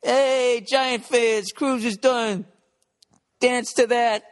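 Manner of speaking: high, gloating drunk voice, hammed up; sloppy drunk glee; mock drunk tweeter. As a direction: crow it like a drunk guy at home laughing at his own joke. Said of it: "somebody was home drunk watching the game".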